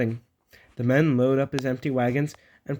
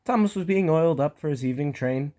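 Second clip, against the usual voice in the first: plain reading voice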